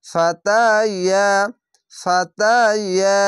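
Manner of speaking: slow chanted ফাতা ইয়া with the long a held; Quran recitation. Shown as a demonstration. Teacher chants ফাতা ইয়া slowly for the class. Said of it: "এখানে আকার স্টেনে পড়বেন".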